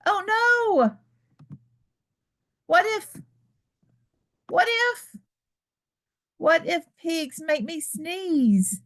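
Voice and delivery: high, anxious rising what ifs, slowing at the end; hammed-up fretting; mock worry. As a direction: fret like a worried child. Gerald fears losing his friend.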